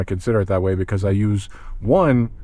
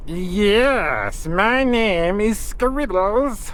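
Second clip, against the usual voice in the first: very high, very slow squeaky rat; goofy rat voice; 9 semitones higher, much slower than usual. Shown as a demonstration. Practising a goofy rat character for his campaign, high and slow. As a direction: stretch it out like a silly little rat. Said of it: "See, that one's not so good".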